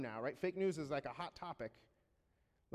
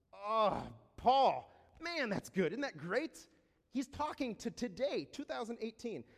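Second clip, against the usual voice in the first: louder, higher burst of delight; excited; 5 semitones higher, 6 dB louder, slower than usual. Real, not acted. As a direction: exclaim over the verse with a raised voice. Delight at the verse.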